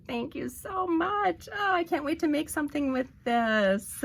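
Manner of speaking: overflowing gratitude to the sender; gushing thanks. Real, not acted. Thanking her friend for the gift, high and gushing.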